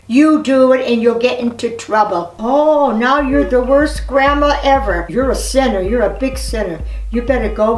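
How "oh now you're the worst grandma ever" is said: usual speaking voice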